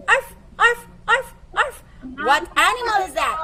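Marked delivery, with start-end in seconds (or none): 0.1-1.8 high, loud imitated dog bark. Shown as a demonstration. Teacher barks like a dog for the kids. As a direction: bark like a little dog for the class. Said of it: "I'm gonna say".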